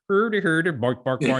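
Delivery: high, loud sing-song mock-Swedish gibberish; Swedish chef. Husband mimicking what people expect his Swedish wife to sound like, mock-Swedish gibberish. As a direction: do a cartoon Swedish cook's nonsense talk. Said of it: "expecting the Swedish chef to talk".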